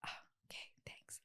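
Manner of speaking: choked, near-silent whispered thanks; crying whisper. Retold or imitated. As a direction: whisper it as if holding back tears. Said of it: "It's like crying".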